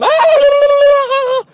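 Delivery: very high wild shriek; wild scream. Shown as a demonstration. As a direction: let out a wild, joyful scream. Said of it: "get wild and scream".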